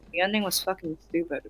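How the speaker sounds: softly, in an exhausted drained voice; barely able to muster the words; tired voice. Retold or imitated. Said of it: "in the most tired voice I can muster".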